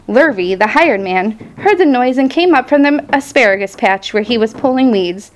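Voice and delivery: ordinary delivery, nothing marked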